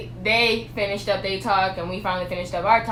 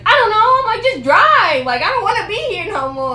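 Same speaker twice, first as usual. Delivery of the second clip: high, loud frustrated answer; loud upset girl telling him to just drive; upset outburst; 12 semitones higher, 8 dB louder than usual. Retold or imitated. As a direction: let it burst out at the boyfriend.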